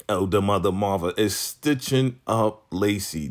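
ordinary talk, nothing marked

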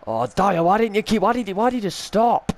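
high, loud exasperated yelling. Real, not acted. Gamer scolding his player Dyer for stopping, exasperated yell.

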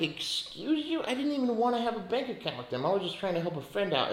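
high, sassy "excuse you" that rushes on fast; mock-offended, talking back to the bank; indignant retort. Retold reply to the bank's overdraft fee, high sassy indignation. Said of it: "And I'm like".

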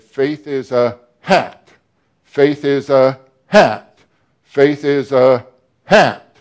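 mock Cockney, slow and loud, dropping in an H; Cockney saying hat for act; Cockney preacher voice, slow and emphatic; Cockney accent. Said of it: "they say everything with an H".

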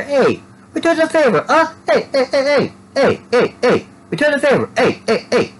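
put-on very high, squeaky accent voice; silly falsetto accent, each word dropping at the end; put-on oddball accent. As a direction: squeak it in a goofy unplaceable accent. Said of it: "What exact accent is that?".

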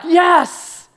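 high, loud cry of delight; exult, with a loud, high yes; excited yes. Acted. Believer hearing God speak to them, excited.

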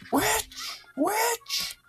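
high squawked accusation; very high, strained cry; mock witch-hunter. Mock accusing shriek at enemy.

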